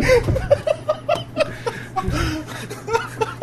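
high laughing fit; big laugh. Real, not acted. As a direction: burst out laughing. Laughing at own joke.

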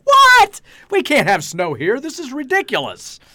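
outraged surprise. Retold or imitated. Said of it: "I was like".

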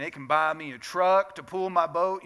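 loud, hammed-up country accent. Preacher quoting a country singer's truck line, country accent. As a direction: lay on your best country twang. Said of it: "that's my best country accent".

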